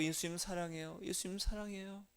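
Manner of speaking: very soft, tender repeated prayer; soft sleepy prayer. Shown as a demonstration. Pastor showing a prayer said on waking, soft and tender. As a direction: whisper it as if just woken at night. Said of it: "자다가 깨도".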